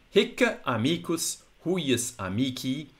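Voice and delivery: hic as "hikk-uh", amici slow with long final i; measured Latin hic amicus huius amici, each vowel length kept; restored classical pronunciation. Shown as a demonstration. Audio course teacher reading exercise two point one, restored classical pronunciation. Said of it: "restored classical pronunciation".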